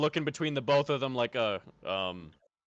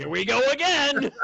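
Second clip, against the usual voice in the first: excited shout; 11 semitones higher, 4 dB louder than usual. Real, not acted. Player exclaiming.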